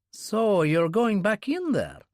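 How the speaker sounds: can't believe he'd go back; incredulous Yuri. Acted. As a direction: Yuri questioning Kurbsky on the phone. Yuri on phone with Kurbsky.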